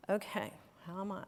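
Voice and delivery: whispered aside to herself; a private whispered check-in; whisper to self. Real, not acted. Speaker checking her own state mid-talk, whispered.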